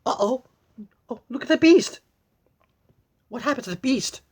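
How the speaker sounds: high, loud cry of alarm. Real, not acted. Reader shocked at the fallen Beast, high loud alarm. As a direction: gasp out at the sight.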